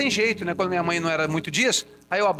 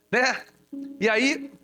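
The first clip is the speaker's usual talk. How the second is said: ordinary delivery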